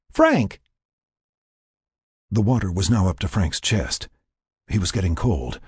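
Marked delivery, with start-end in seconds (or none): 0.1-0.6 very high, falling cry of a name; urgent call. A narrator's reading. Lynn gets no answer from Frank, urgent call.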